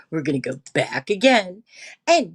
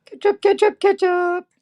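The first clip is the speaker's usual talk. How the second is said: high, loud singsong call; playful high-pitched summons; 11 semitones higher, 6 dB louder than usual. Real, not acted. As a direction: call out repeatedly for someone. Calling out for Ketchup.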